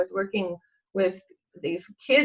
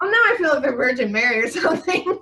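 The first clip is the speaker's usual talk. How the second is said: fast, laughing self-mockery; amused quip; 4 semitones higher, 4 dB louder, faster than usual. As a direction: joke at yourself, giggling. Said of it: "And I'm like".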